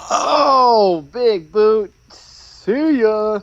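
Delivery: high, loud cry at the big hit; excited play-by-play call. Real, not acted. Commentator calling a big boot in the match, excited. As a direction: call the knockout high and drawn out.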